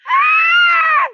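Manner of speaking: long, very high, loud scream; piercingly high held scream. A narrator's reading. Neighbor screams down the street, high scream. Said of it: "I heard a scream".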